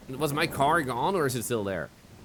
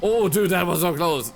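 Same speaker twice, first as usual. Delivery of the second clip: relieved excitement; 6 semitones higher, 8 dB louder than usual. Real, not acted. Just escaped a chase.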